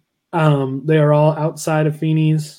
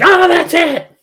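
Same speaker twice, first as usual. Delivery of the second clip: high, loud snap of frustration; exasperated outburst; 14 semitones higher, 5 dB louder, slower than usual. Retold or imitated. Eric blowing up at Jack's apple bite, exasperated shout. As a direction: blow up at the crunching.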